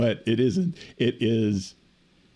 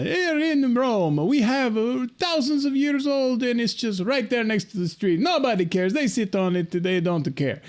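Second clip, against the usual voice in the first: Italian tour guide; 11 semitones higher than usual. Retold or imitated. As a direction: narrate the ruins like a corny Italian cabbie. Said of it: "he's showing me all these things".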